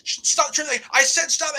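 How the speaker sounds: high-pitched retold outburst; imitated angry command. Irate wrestler ordering the cameraman to stop, imitated high and agitated. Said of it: "he goes".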